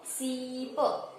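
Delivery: सीप very slowly, a long held "see" then a short "p"; demonstrated whole word. Shown as a demonstration. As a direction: stretch the ई in सीप. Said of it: "उसका उच्चारण क्या होता है". (सीप में ई को लंबा खींचें)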